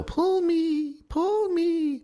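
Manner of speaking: very high, slow, beckoning little voice; calling tinsel. Pretend. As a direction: coax in a drawn-out falsetto, as a mock temptation. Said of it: "it calls to you".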